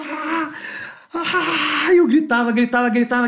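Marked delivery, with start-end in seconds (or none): 0.1-1.9 retold yell. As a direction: yelp it out high, retelling the moment.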